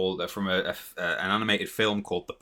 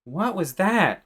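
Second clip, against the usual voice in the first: put-on high, drawn-out little boy question; 10 semitones higher, 3 dB louder, slower than usual. Acting out the cartoon boy's line, high little boy. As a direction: put on a high child's voice, stretching it out. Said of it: "The, the little boy goes".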